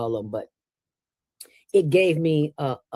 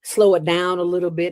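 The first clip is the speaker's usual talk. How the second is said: ordinary delivery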